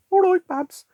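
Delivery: loud, jokey Brummie impression; mock Brummie accent. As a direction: shriek it like a cartoon Brummie. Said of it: "having a silly British accent".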